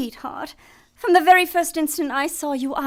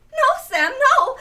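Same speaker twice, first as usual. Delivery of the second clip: nervous pleading; 9 semitones higher, 5 dB louder, slower than usual. Acted. Cornered man pleads, laughing nervously.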